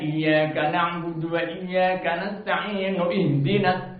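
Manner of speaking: "ihdinas" keeps its initial i after "nasta'in"; wrong joined recitation. Shown as a demonstration. Teacher repeating the wrong reading, chanted "nasta'inu-ihdinas". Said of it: "salah baca begitu".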